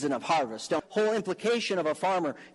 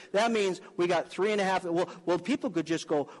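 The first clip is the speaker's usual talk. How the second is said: normal talking voice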